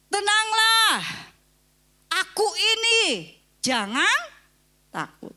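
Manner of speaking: high, loud, slow call to the disciples; Jesus calming. Retold or imitated. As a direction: call out over the storm to calm them. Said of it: "Yesus berkata". (Yesus menenangkan murid dengan seruan tinggi)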